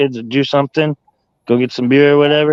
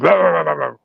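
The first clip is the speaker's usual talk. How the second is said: rapid-fire guard-dog growling noise, higher pitched; imitated dog growl; 4 semitones higher than usual. Beagle claiming the food bowl, mock growling.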